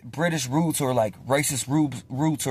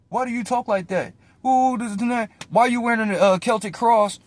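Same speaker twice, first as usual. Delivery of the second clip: higher-pitched mocking imitation of a critic; imitated nitpicking commenter; weird online commenter; 5 semitones higher than usual. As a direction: mimic a nosy commenter, pitched up. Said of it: "people are coming in on my comments being weird".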